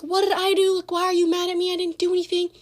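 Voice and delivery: high, loud, whiny yell of innocence; retold yelling. Girl yelling at me in the bus line, loud high protest. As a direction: shriek the denial at her across the bus line. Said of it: "yelling at me in the frickin'".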